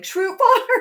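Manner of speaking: high, loud fan holler; excited fans. Retold or imitated. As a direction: shout it like fans spotting a landmark. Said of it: "people are like".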